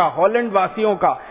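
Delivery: normal talking voice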